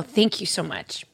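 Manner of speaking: soft breathy whisper, close to tears; whispered thanks. Real, not acted. Whispering thanks to a listener, near tears. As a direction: barely voice it, holding back tears.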